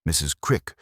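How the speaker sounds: ordinary delivery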